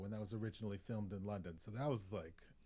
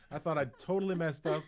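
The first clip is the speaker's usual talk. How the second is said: ordinary delivery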